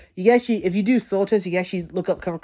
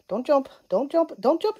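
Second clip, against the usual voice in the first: higher each time, pleading; rising alarm; 8 semitones higher, slower than usual. Real, not acted. Speaker begging the kitten on her shoulder not to jump, rising.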